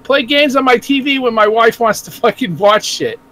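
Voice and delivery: normal talking voice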